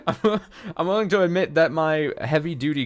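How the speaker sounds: high giggling admission; laughing. Real, not acted. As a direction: laugh through the words. Laughs admitting weak ship.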